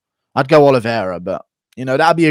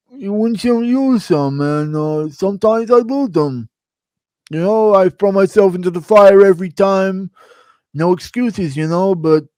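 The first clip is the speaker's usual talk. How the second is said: higher-pitched put-on fighter voice; Gaethje; 6 semitones higher, 3 dB louder than usual. Streamer impersonating a fighter making excuses, on viewer request. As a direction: do an impression of a fighter shrugging off a loss. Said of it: "Impression of Gaethje making excuses".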